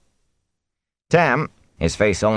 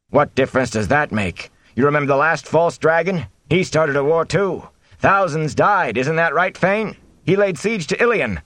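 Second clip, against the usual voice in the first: agitated, higher-pitched arguer; villager shouting; 10 semitones higher than usual. A narrator's reading. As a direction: argue back over the crowd. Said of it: "men and women shouting over one another".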